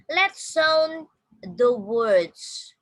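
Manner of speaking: very slow, loud teacher's instruction; loud, spaced-out classroom English. Shown as a demonstration. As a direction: stretch each word out for learners. Teacher announces a pronunciation drill.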